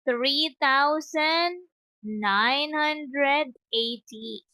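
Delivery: "three thousand nine hundred eighty-eight" very slowly, word by word; slow model reading. Shown as a demonstration. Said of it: "another one".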